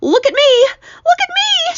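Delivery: very high, loud squeal; high-pitched gleeful yelling; excited shout. A narrator's reading. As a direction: shout for everyone's attention, thrilled. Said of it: "shouted the centipede excitedly".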